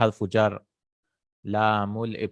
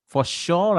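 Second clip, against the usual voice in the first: "sure" pitched up high; emphatic; 7 semitones higher, 3 dB louder than usual. Shown as a demonstration. Teacher showing verbal emphasis, "sure" raised high. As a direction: lift "sure" well above the rest. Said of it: "that is emphasis".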